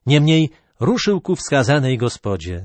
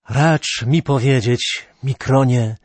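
ordinary delivery, nothing marked

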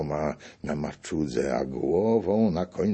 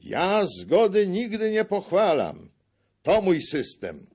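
much higher and louder; shouted protest; 13 semitones higher, 5 dB louder than usual. A narrator's reading. Buchman shouts against agreement, high and loud. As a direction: shout your stubborn objection. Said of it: "Buchman krzyknął".

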